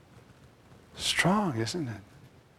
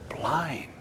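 whispered verdict; 3 semitones higher, 5 dB quieter than usual. Real, not acted. One-word verdict after long pause.

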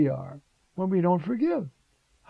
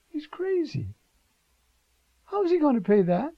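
high, disbelieving he's crazy; mock astonishment; incredulous; 10 semitones higher than usual. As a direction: scoff at the idea, pitch jumping up. Incredulous comment on servant.

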